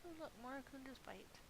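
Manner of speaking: normal talking voice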